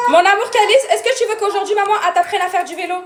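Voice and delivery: mother coaxing upset son, hopeful; coaxing offer. Real, not acted. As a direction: tempt him out of his sulk. Coaxing son with bike ride.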